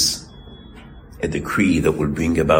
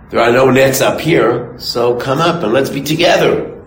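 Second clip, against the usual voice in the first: higher, louder, luring call to the fish; sly fox coaxing; 5 semitones higher, 5 dB louder than usual. Retold or imitated. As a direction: reel them in with a sweet lure.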